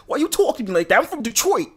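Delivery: imitating his own troll bit, high and loud; baddie boy voice. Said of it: "how I tried to act the same way".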